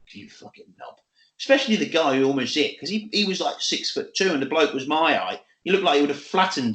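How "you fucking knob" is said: breathy whisper, no voice at all; a scornful whisper; whispered insult. Retold or imitated. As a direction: hiss it under your breath at him. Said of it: "just thinks".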